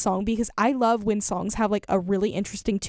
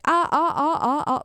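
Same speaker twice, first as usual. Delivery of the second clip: bright staccato ah sounds, higher; vocal noise demo; 5 semitones higher than usual. Shown as a demonstration. DJ showing listeners the noisy singing she loves. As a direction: show the singer's vocal flips. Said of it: "where they just start going".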